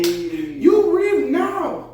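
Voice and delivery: high, slow, loud order; mock staff shout. Restaurant staff ejecting big eaters, shouting. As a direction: throw them out with a shout.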